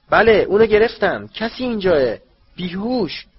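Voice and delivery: loud high shout of triumph. Acted. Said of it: "صدای فریاد آقای دیگوری". (فریاد بلند و زیر)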